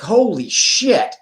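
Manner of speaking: high, drawn-out mock shock; startled exclamation. Someone rushed at full speed by thirty people, startled. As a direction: act out being caught off guard, stretching it slowly. Acted reaction to being rushed.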